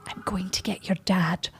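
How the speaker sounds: imitating mum's hushed scheming; mock whisper. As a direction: whisper it like a secret plan. Mum confiding a gift plan.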